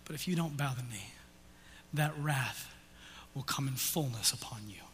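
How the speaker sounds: hushed, breathy warning whisper; a grave whispered warning to the congregation. Real, not acted. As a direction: lean in and whisper the threat. Quiet warning of coming wrath.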